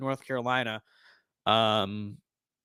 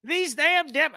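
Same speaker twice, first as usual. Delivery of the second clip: high, slow Southern drawl, hammed up; exaggerated Southern accent, high and slow; mock Southern Florida accent; 12 semitones higher, much slower than usual. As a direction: play a Florida good old boy griping about Democrats. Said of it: "Florida's people's accents are not that thick".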